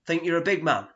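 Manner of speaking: hammed-up pub bruiser; pub hard man. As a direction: needle him like a lout. Pub lout squaring up.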